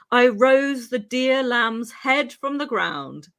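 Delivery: normal talking voice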